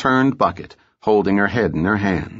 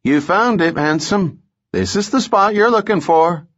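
much higher calling voice; young woman calling; 8 semitones higher than usual. A narrator's reading. Young woman calls to handsome stranger from boxcar, high call. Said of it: "a young woman with a runny nose called".

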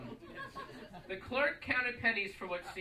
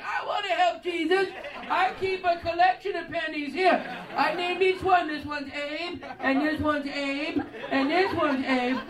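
very high, loud, dopey caricature; mock simple-minded helper; 9 semitones higher, 8 dB louder, slower than usual. Simple clerk offering his pennies to help, dopey caricature. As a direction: shout it slowly with eager, childlike simplicity.

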